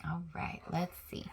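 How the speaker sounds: hushed whisper. Real, not acted. Teacher whispering to her class before the story. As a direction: whisper it to draw them in.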